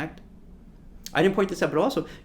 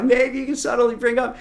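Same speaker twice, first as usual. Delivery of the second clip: high and louder, amused repeat; laugh, then push it out high and louder; 8 semitones higher, 6 dB louder than usual. Shown as a demonstration. Coach repeating his advice after a laugh, amused high voice.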